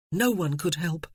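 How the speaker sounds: usual speaking voice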